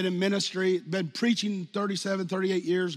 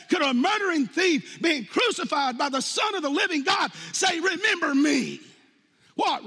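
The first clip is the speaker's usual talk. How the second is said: indignant shouting; 5 semitones higher than usual. Acted. Preacher voicing outrage at the thief's audacity, shouting. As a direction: shout it like a scandalized accuser.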